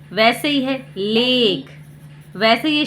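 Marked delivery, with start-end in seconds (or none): 1.0-1.7 लेक with a stretched "ay"; long A sound. Shown as a demonstration. Teacher adding an example, लेक with long "ay". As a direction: draw out the vowel in लेक. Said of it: "इसे ए ही पढ़ेंगे".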